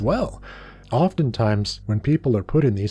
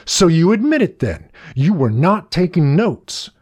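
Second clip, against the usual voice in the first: loud, higher-pitched accusation; accusing teacher; 7 semitones higher, 5 dB louder than usual. A narrator's reading. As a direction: pounce loudly, stressing each word. Teacher pounces on the admission.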